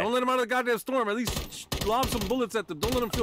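urgent shouting. Real, not acted. Urging teammates mid-fight.

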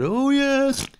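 high, slow put-on customer voice; a wide-eyed punter telling the tale; pet shop customer. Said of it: "And he said".

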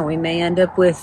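ordinary talk

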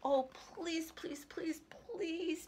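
high-pitched, drawn-out pleading; begging child. A narrator's reading. Cassie begs her parents for a puppy, begging. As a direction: plead like a little girl begging. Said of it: "begged Cassie".

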